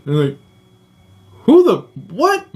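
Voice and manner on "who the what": high-pitched stunned outburst; sputtering, high mock outrage; retold outraged disbelief. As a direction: imitate a brother losing it. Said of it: "My oldest brother lost his fucking mind".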